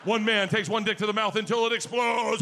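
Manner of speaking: loud dramatic announcer voice. Retold or imitated. Friend Tom doing a loud TV announcer voice. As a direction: boom it out like a TV show intro. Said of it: "and go, 'This week".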